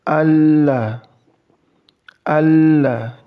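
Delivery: Allah, with a thick, held "ll" sound; Allah with heavy lam. Shown as a demonstration. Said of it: "disambung dengan huruf lam tanda baca fathah".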